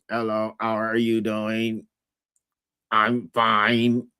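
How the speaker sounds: low, slow, deadpan imitation; dry robotic mimicry. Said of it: "You be all dry and stuff".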